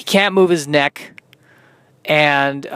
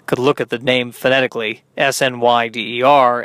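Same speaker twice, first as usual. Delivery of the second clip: usual speaking voice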